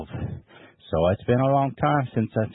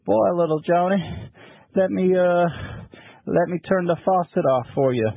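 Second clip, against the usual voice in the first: pappy's voice; 6 semitones higher than usual. Retold or imitated. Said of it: "and he said".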